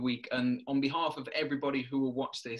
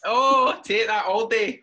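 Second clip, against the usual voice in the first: loud, high mock Scottish accent; playful stab at a broad Scottish accent; Scottish accent attempt; 8 semitones higher, 10 dB louder, slower than usual. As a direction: belt it out in a hammed-up Scottish accent. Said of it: "What do you think of mine?".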